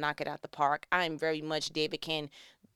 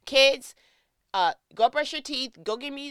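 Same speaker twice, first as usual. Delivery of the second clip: put-on raised, higher voice calling to the kids; parent giving orders; 7 semitones higher, 7 dB louder than usual. Husband taking over, telling the kids what to do, commanding. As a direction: call out brisk household commands.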